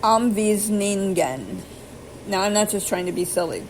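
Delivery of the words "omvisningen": "Omvisningen" drawn out slowly, syllable by syllable; careful slow "Omvisningen"; Norwegian word read out. Shown as a demonstration. Reading Norwegian from Fast Talk book, slow careful "Omvisningen". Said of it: "that's how they say to pronounce it".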